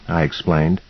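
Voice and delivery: plain reading voice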